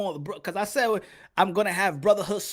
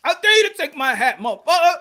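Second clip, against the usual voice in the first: mock taunt; 10 semitones higher, 13 dB louder than usual. Taunting someone to take hat.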